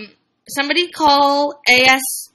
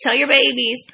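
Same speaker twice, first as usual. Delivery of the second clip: ordinary talk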